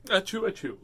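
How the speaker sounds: loud mock sneeze; playful fake achoo. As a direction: sneeze it out, hammed up.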